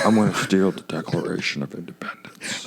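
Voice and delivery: extremely low, soft impression; attempted Nick Cage. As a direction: sink to a quiet rumbling imitation. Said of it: "say this line as Nick Cage".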